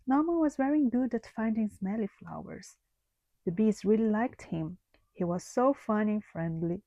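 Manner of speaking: usual speaking voice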